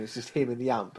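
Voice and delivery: ordinary talk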